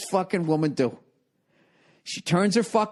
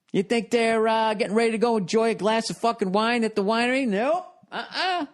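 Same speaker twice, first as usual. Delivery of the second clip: teasing high voice; 6 semitones higher than usual. Acted. Teasing audience about the ladies.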